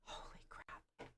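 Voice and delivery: breathless whisper of dismay; whispered holy crap. Retold or imitated. Streamer recalls hearing the hugging was overwhelming, whispered dismay. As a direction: mouth it like you're quietly appalled. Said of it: "I was like".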